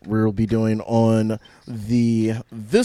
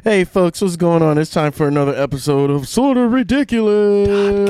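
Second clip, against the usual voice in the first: announcer voice; 7 semitones higher, 4 dB louder than usual. Acted. Podcast show intro.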